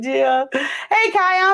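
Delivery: usual speaking voice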